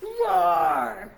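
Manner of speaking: loud playful roar; dinosaur roar. Acted. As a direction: roar at the kids.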